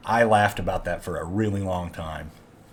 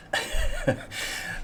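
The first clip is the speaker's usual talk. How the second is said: gleeful breathy laugh; 8 dB quieter than usual. Real, not acted. Gloating over Trump supporters.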